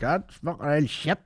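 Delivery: put-on quiet, high grumble of an old dad; grumpy old dad. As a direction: mutter it like a cranky old man. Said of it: "someone's grumpy old dad".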